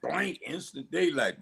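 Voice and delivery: usual speaking voice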